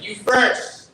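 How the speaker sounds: loud, high snap back; yelled retort. Retold or imitated. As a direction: fire it back across the road. Yelled back across street.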